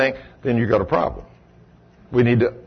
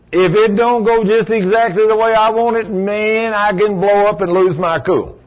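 higher, louder hammed-up flare of temper; mock blow-up; 6 semitones higher, 3 dB louder than usual. Preacher to congregation, acting out a hot-tempered grumbler, mock outburst. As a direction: play a man about to lose his cool. Preacher acts out losing his cool.

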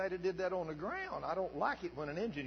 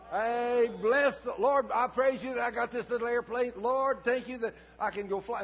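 higher, louder, joyful praise; 9 semitones higher, 9 dB louder than usual. Shown as a demonstration. Pastor shows the church how to praise God, joyful and loud. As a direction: shout thanks to the Lord. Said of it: "what am I supposed to be doing?".

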